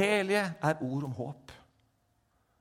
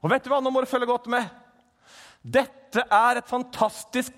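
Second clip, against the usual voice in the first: high, loud fervent preaching; preaching at full force; 9 semitones higher, 10 dB louder than usual. Acted. Preacher proclaiming God's intervention, loud and fervent. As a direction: thunder it to the congregation.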